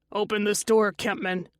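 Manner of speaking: usual speaking voice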